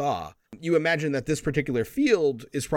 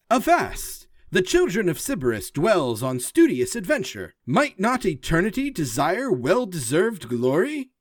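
robot declaiming poem; 6 semitones higher than usual. Acted. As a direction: declaim like a proud machine poet. Forge recites second poem.

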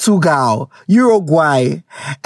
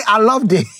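ordinary talk, nothing marked

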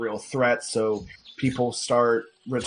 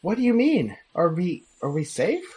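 high worried voice; 7 semitones higher than usual. Acted. GM as Rena asks.

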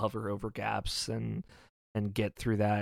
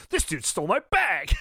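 higher, louder playful exclamation; mock outrage; 9 semitones higher, 5 dB louder than usual. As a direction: cry out in mock indignation at a theft. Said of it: "and you're like".

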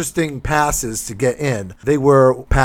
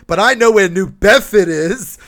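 "New Bedford" said the local way, like New Bedfid, higher and louder; local Massachusetts accent; 6 semitones higher, 6 dB louder than usual. Retold or imitated. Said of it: "He was from the area".

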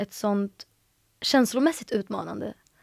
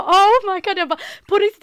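high, loud disbelieving cries; can't believe the acceptance is real; stunned disbelief; 10 semitones higher, 7 dB louder than usual. Retold or imitated. (chockad, högljudd misstro)

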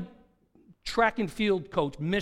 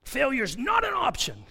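mock shout; 8 semitones higher, 3 dB louder than usual. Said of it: "I've heard that".

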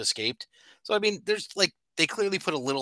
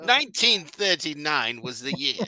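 newsreel announcer; 5 semitones higher, 5 dB louder than usual. Acted. Mock old newsreel announcer.

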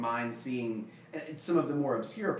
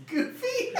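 squeaky high. Acted. Goofy origins joke.

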